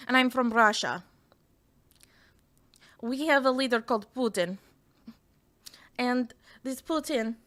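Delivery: put-on, heavy Russian accent. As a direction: introduce yourself in a mock Russian accent, deliberately paced. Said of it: "I'll do the Russian accent".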